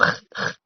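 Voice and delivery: "h" scraped hard in the throat; mock the guttural Scouse "h"; "h" like clearing the throat; throaty Scouse h. Shown as a demonstration. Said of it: "sounds like he has, like, throat problems".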